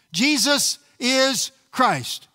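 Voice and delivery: slow, each word weighed; slow emphatic declaration. Acted. Preacher declaring the message to the church, slow and weighty. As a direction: lay down Jesus is Christ firmly.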